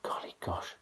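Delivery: whispered golly gosh; murmured softly. A narrator's reading. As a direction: breathe it to yourself. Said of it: "He murmured softly".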